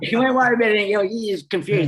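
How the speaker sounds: high, fast, laughing through the words; giddy laughing retelling. Real, not acted. Laughing at the guard's reaction.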